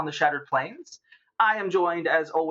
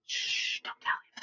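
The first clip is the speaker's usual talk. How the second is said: hushed unvoiced whisper; 9 dB quieter than usual. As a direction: hush them, keep it secret. Hushing so he won't hear.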